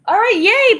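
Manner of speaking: high, loud happy cheer; real excited cheer. Real, not acted. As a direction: celebrate finishing. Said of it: "finished the bag".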